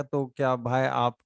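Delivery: ordinary talk, nothing marked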